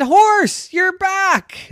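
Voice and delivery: overjoyed. Acted. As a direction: welcome the lost horse with delight. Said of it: "And she said".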